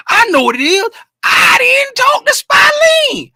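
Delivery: hammed-up horror, building to a stretched scream; mock anguished outburst. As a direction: cry it out like a comic disaster. Acts out own sudden realization.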